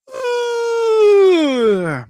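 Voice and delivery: a shrill burst of laughter, breaking high; high squealing laugh. Real, not acted. Streamer cracking up at something on screen, squealing laugh. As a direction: let a real high laugh burst out and fade.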